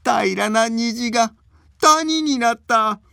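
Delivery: put-on high hearty old man laughter; belly-laughing old man. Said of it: "腹を抱えて笑っている".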